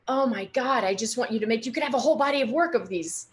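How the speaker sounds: high, loud, urgent exclamation; intense voice. Retold or imitated. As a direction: imitate an intense mentor pressing the point. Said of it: "has this kind of intense voice".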